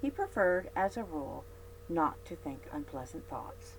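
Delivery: ordinary delivery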